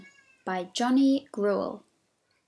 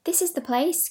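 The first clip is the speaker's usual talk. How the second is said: excited cry; 7 semitones higher than usual. Acted. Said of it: "cried Raggedy Ann".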